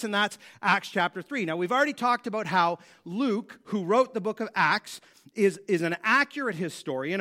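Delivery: normal talking voice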